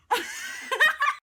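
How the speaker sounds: high-pitched burst of laughter; real laugh. Real, not acted. Breaking off from the horror story, high laugh.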